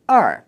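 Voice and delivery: "er" falling straight down; fourth tone. Shown as a demonstration. Teacher showing learners number two, falling fourth tone. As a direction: drop the pitch straight down through "er". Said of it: "That's the fourth tone, straight down".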